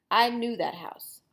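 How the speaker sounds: ordinary delivery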